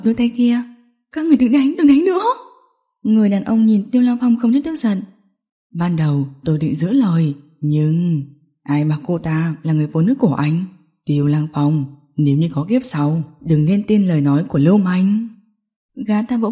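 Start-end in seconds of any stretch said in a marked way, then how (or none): 1.1-2.2 high, rising female cry; desperate high-pitched protest; frantic pleading. Acted. Woman begs attackers to stop beating. 5.7-15.3 low-pitched gang boss; sneering low threat; cold villain. Acted. Voices the villain in the story.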